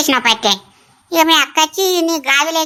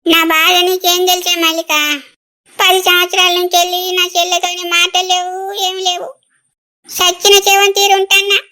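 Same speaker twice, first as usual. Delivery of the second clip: crying lament; 3 semitones higher than usual. Acted. Aunt telling of ten years without her sister, crying. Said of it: "ఏడవకత్తమ్మ, ఎందుకు ఏడుస్తున్నావు".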